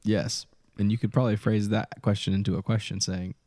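usual speaking voice

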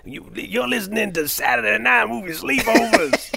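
radio DJ voice. Retold or imitated. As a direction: ham up a retro radio host. Radio DJ voice after Wolfman Jack.

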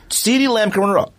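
ordinary talk

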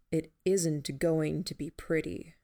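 ordinary delivery

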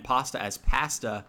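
second "pasta" with A as in "cat"; slow contrast, "pahsta" then "pass-ta"; flat-A pronunciation. Retold or imitated. Brother's friends' way of talking, flat-A second "pasta". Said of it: "to say their A's like that".

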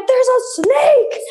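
high, slow alarmed warning; alarmed cry. Retold or imitated. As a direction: shout the warning high and drawn out. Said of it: "I was like".

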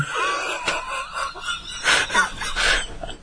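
high rolling laughter; long laugh. Real, not acted. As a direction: laugh at your own refusal. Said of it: "long laugh".

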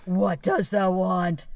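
slow, drawn-out sinister question; slow mock-demonic goat; devil goat voice. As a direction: stretch it out like a tempting devil. Replaying the devil goat's line.

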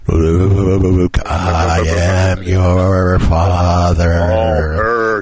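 slow, loud Vader voice through a fan; Darth Vader. Retold or imitated. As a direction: drag it out like a movie villain. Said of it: "But I sound like Darth Vader".

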